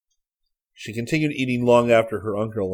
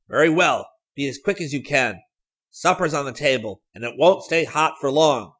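uncle calling through a door, louder; 6 semitones higher, 8 dB louder than usual. A narrator's reading. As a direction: raise your voice to the next room. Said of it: "Grant Wickham's voice called".

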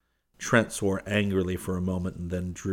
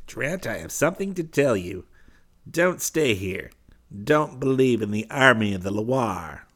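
lightly voiced Southwark, a touch higher and urgent; urgent warning; 3 semitones higher, 5 dB louder than usual. Southwark warns Trent.

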